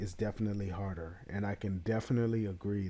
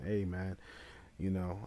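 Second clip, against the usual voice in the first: normal talking voice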